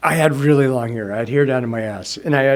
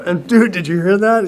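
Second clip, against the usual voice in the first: lightly voiced excited hippie, higher; a hint of a stoned dude amazed; hippie slang; 6 semitones higher, 5 dB louder than usual. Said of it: "back then my language was like".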